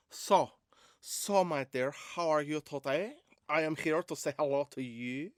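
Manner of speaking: playful, badly faked Spanish accent. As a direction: greet like a Spanish terrier, accent laid on thick. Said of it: "If the Spanish Terrier".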